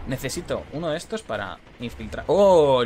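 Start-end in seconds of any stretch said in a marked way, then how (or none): 2.3-2.8 sudden loud gasp of surprise; startled oh. Real, not acted. Gamer on stream startled by an attack, loud surprised oh. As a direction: caught off guard, cry out. Surprise during game fight.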